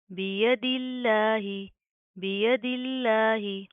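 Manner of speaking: बियदिल्लाही drawn out slowly with a thin, light lam; slow chanted Quranic recitation. Shown as a demonstration. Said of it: "लफ्ज़ अल्लाह को बारीक पढ़ेंगे".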